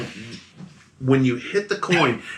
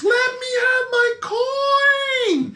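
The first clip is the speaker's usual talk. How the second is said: put-on shrieking old lady; slower than usual. Old lady demands her coin back, shrieking. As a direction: wail it as a desperate old woman. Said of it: "she starts shrieking at the top of her lungs".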